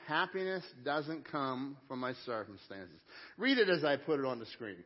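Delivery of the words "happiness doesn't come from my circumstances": slow, measured group recitation; recited together. Shown as a demonstration. Pastor reciting the line with the congregation, slow and measured. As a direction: lead the room through it word by word, slowly. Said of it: "Would you say that with me?".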